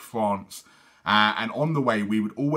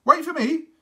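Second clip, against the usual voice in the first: put-on high little girl calling; 5 dB louder than usual. Little Sophie calling after brothers.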